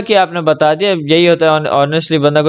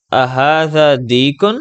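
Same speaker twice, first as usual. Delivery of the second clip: slow model reading; much slower than usual. Shown as a demonstration. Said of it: "ھمزۃ الاستفہام لگ گیا نا؟".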